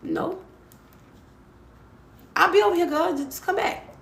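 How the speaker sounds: high, sassy Southern refusal; sassy Louisiana accent. Retold or imitated. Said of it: "that accent".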